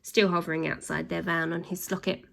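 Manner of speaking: usual speaking voice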